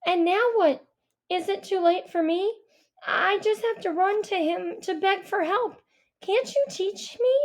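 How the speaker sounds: high, rising, pleading questions; desperate plea. Acted. Owl begging Avery to teach her, high and pleading. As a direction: beg him, voice climbing to the top.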